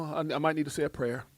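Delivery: ordinary talk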